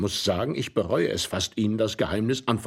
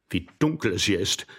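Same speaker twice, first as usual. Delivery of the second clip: ordinary delivery, nothing marked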